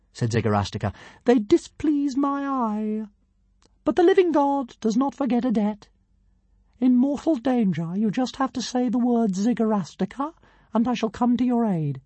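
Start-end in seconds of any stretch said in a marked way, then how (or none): 1.2-12.0 pompous tiny dragon. A narrator's reading. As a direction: announce yourself like a pint-sized deity.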